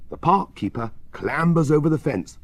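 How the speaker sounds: ordinary delivery, nothing marked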